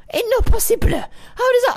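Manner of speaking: high, crying complaint; whiny protest. Real, not acted. Whiny disbelief at cost.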